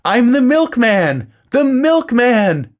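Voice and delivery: high, drawn-out holler; acted shout. A narrator's reading. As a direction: shout it slowly and high to be heard. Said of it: "so I shouted".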